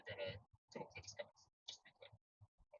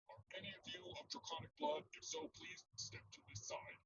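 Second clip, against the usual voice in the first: higher-pitched inspector voice; Gyupo. Acted. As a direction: address the group as a scout officer. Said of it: "Gyupo addresses the group at large and asks".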